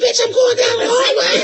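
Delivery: high, loud exasperated yell; mock shouted retort. Husband yelling at wife from the hallway, exasperated. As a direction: bark it back down the hall, half laughing. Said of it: "He be like".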